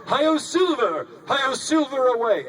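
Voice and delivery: high, slow, loud cowboy yell; cowboy shout. Shown as a demonstration. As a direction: call out like a Lone Ranger charging off. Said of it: "the entire way shouting".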